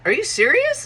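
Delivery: high disbelieving question; incredulous. Retold or imitated. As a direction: ask it in shocked disbelief. Retold incredulous question from him.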